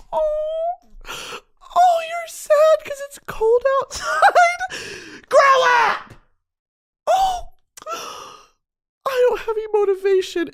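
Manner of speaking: high, slow sobbing whine; mock whiny baby. Mocking herself for being sad about cold weather, crybaby whine. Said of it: "get a grip, dude".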